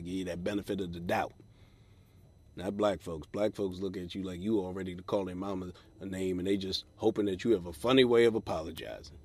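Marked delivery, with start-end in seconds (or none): none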